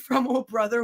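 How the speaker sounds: ordinary talk, nothing marked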